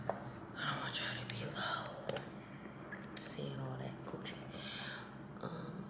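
hushed, toneless whisper. Real, not acted. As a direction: whisper it so nobody hears. No pants on, hiding from camera.

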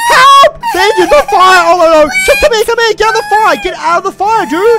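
very loud, high screaming; full-volume panicked yelling; mock terror. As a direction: shout frantically through a pretend emergency. Engine catches fire in game.

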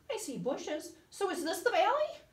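a little koala hoping they've arrived; lightly voiced hopeful koala. A narrator's reading.

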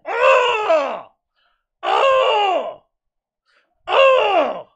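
high-pitched hype screams; loud, drawn-out high yell; excited scream. Real, not acted. Hype about a new laptop.

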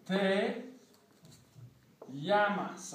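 "llamas" said as "yamas"; Y sound in "Te llamas"; double L like Y. Shown as a demonstration. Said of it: "sounds like a Y".

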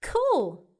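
usual speaking voice

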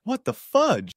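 high-pitched startled cry; shocked exclamation. A narrator's reading. Downstairs neighbor after a massive fart, high shocked exclamation.

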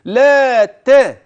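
لا ت chanted long and thin, light ل and ت; light tāʾ. Shown as a demonstration. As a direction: draw out لا, then a light open ت. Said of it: "ترقق".

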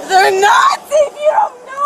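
crying shout. Real, not acted.

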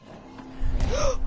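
sharp, high intake of breath; startled gasp. Real, not acted. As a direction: gasp in sudden shock. Startled in the game.

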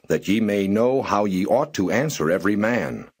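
usual speaking voice

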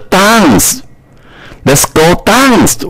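dance with a broad British ah, Let's go dance high and bright; British accent. Shown as a demonstration. Teacher contrasting British dance with American. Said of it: "英国音是dance".

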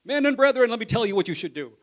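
high, loud shouted preaching; fiery preaching. Retold or imitated. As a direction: preach it with full force. Peter preaching at Pentecost.

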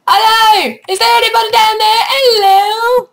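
high loud sing-song call. Real, not acted. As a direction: call down into the dark. Calling down a hole.

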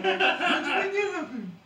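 high, slow, laughing cartoon voice; drawn-out amazed cartoon caveman. Caveman marvelling at a burger machine, high, slow and laughing.

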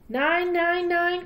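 loud high auction call; calling out bids. Acted. Auctioneer calling the bid to the crowd, loud and high. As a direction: sing out the bid to the room. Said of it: "called the auctioneer".

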